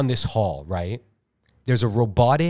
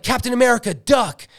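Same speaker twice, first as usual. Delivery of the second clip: high-pitched acted warning call; urgent warning; 11 semitones higher, 3 dB louder than usual. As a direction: call out a sudden warning to a teammate. Acted warning shout in action scene.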